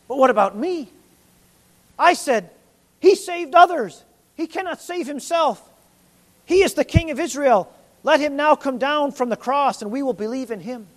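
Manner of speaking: a troubled listener reliving his taunts; anguished listener. Retold or imitated. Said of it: "who's sitting ... thinks".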